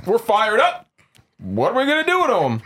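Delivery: high, rousing and slow; fired-up mob leader. Pretend. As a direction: whip up the crowd. Rallying mob about prisoners.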